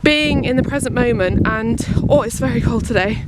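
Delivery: ordinary talk, nothing marked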